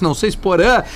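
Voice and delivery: usual speaking voice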